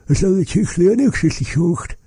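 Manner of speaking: squeaky imitation of the customer asking; high mock customer voice. Imitating the store customer, asking in a high voice.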